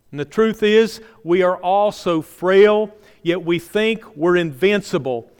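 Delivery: ordinary talk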